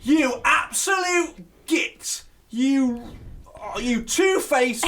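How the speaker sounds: high, slow, drawn-out insults at the telly; re-enacted outraged rant; mock screaming. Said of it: "I'm screaming at the telly going".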